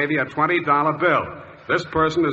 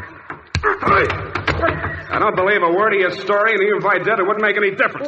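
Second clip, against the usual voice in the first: loud, high, furious dressing-down; full-voiced yell of disbelief; angry shout; 7 semitones higher, 4 dB louder than usual. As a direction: blast him for lying. Detective turns on lying suspect.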